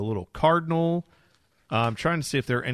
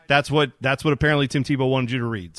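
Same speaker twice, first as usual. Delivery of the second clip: normal talking voice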